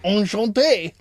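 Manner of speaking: put-on loud drawn-out "oh" in clumsy French accent; badly done French accent. Mocking Jason's attempt at French, exaggerated bad French accent. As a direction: overdo a poor French accent. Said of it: "that is how you do a French accent, not".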